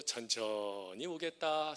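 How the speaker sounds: lazily drawn-out Chungcheong speech; mock slow country reply; slow Chungcheong drawl. Pastor joking how Chungcheong folk answer God, slow drawl. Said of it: "말이 느리니까 하나님이 부르실 때도".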